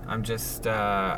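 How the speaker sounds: normal talking voice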